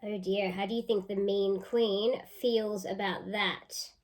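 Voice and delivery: normal talking voice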